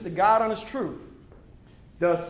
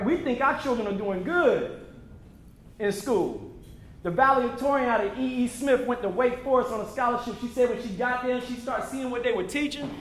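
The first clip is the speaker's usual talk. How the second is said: usual speaking voice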